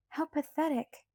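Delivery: plain reading voice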